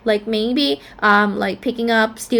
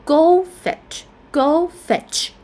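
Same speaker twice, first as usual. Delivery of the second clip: "goldfish" stressed on GOLD, raised higher; 5 semitones higher, slower than usual. Shown as a demonstration. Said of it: "first syllable stress".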